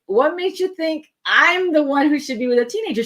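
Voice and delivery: can't believe she's asking you; incredulous. Retold or imitated. Disbelieving answer to the request.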